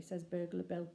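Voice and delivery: ordinary delivery, nothing marked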